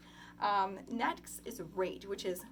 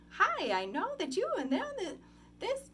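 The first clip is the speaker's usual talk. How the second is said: high, exaggerated sing-song as if to children; 12 semitones higher, 5 dB louder than usual. Shown as a demonstration. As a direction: swoop the pitch up and down in big, loud waves. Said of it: "speak very sing-song".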